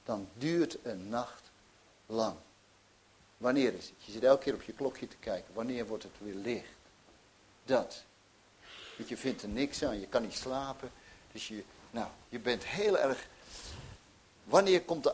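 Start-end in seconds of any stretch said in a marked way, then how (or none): none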